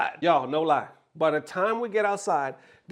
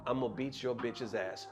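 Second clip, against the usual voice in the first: much lower and softer, sneering; low menacing threat; 9 semitones lower, 11 dB quieter than usual. Retold or imitated. Crazy girl threatening the boyfriend, low quiet menace. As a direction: growl it under your breath. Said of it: "talking about".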